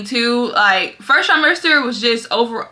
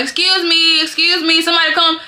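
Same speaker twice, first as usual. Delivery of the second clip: raised mock call for the nurse; retold calling out; 6 semitones higher than usual. Pregnant patient calling the nurse to unhook her, raised voice. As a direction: call out for someone to come, half-yelled. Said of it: "I'm yelling".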